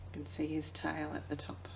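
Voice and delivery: quick hushed whisper; fast whisper. A narrator's reading. Reading picture book to child.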